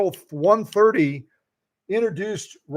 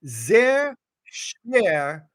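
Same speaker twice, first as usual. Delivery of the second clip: slow, careful German words; slow German demo. Shown as a demonstration. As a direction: draw out each syllable. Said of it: "I know it sounds like I'm saying gibberish".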